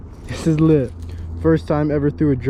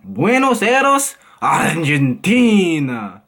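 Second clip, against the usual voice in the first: put-on Argentine accent; 2 semitones higher, much slower than usual. Vlogger doing city impressions from a list, Argentine accent. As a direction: stretch the city and country slowly, hammed-up Argentine accent. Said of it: "change my voice and do some impressions".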